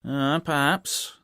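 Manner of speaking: slow, drawn-out musing landlord; hearty landlord considering. Acted. Landlord voiced in story.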